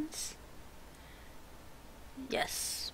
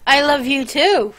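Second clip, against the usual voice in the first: loud, higher sarcastic comeback; mock-offended; 5 semitones higher, 9 dB louder than usual. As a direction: play hurt, loudly. Mock-offended retort to sister's teasing.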